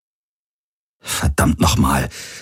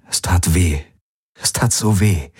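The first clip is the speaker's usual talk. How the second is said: ordinary delivery, nothing marked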